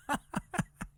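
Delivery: high squeaky laugh that hurts; painful laugh. Real, not acted. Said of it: "It hurts when I, when I laugh".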